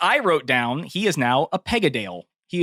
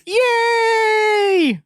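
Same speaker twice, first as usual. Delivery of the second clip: high squealing mock glee; mock cheer; 4 dB louder than usual. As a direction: squeal with fake delight.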